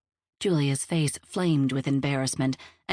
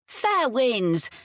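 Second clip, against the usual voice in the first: high farewell call; 7 semitones higher than usual. A narrator's reading. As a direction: call a send-off after departing friends. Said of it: "Genevieve called before going back".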